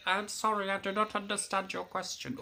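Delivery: high, tinny voice-assistant impression; tinny robot voice. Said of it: "the voice come back saying".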